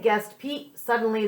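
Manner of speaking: ordinary delivery, nothing marked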